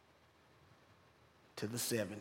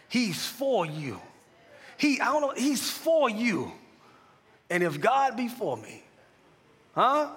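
louder, higher preaching over music; preacher lifting the congregation toward the name of Jesus; preaching at full force; 4 semitones higher, 5 dB louder than usual. Acted. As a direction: build the sermon to a rousing peak.

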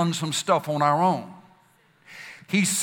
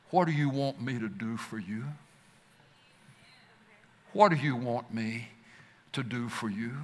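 softer, lower tender asking; gentle question; 6 semitones lower, 7 dB quieter than usual. Retold or imitated. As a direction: ask as a kind master would, quietly. Jesus asks Bartimaeus gently.

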